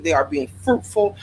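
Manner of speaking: normal talking voice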